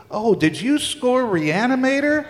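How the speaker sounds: starstruck cop. Retold or imitated. Cop recognizes the composer.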